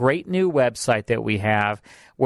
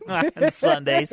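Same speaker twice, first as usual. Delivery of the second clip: laughing; 13 semitones higher, slower than usual. Real, not acted. Laughing at own loneliness admission.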